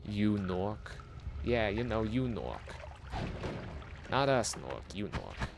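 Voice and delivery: put-on New York accent. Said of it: "Talking like I'm from frickin' New Nor".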